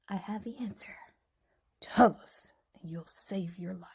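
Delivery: secret hushed whisper; conspiratorial whisper. Acted. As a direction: whisper so no one hears. Secretly passes the answer.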